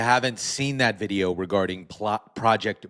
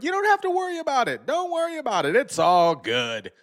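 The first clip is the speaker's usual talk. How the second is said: mock reassurance. Mocking officials' reassurance.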